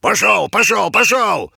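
high, loud barking orders; roaring tyrant king. Acted. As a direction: drive him off, shouting. King driving Pushok off.

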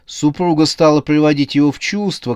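usual speaking voice

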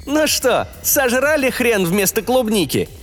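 high, gloating exclamation; triumphant AI. A narrator's reading. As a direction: crow over the losers. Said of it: "победно воскликнул искусственный интеллект".

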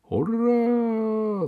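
высокое протяжное «ура»; кричи, как ликующая трибуна; крик болельщиков. A narrator's reading. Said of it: "закричали на стадионе". (ликующий крик)

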